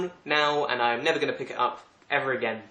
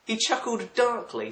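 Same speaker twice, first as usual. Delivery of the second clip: slow, higher-pitched questioning of the phrase; incredulous; 9 semitones higher than usual. Real, not acted. Reader puzzling over how to chuckle darkly, incredulous. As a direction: read it back in slow disbelief.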